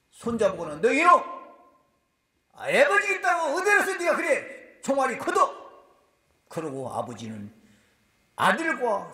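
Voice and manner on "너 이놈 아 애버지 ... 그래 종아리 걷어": loud, higher scolding shout; angry grandfather. Retold or imitated. Preacher acting grandfather scolding his son, loud angry shout. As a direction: roar at the son like an angry elder.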